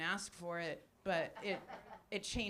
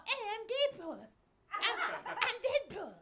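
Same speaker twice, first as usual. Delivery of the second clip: much higher, squeaky mock Deadpool voice; a Cartman-ish high squeal of a superhero; high-pitched Ryan Reynolds; 13 semitones higher than usual. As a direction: pitch way up like a cartoon kid. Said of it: "I think he's got a high-pitched voice".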